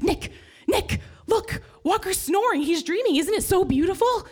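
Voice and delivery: high, thrilled wake-up call; wife waking husband, high and excited. Retold or imitated. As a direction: gush to your husband in delight.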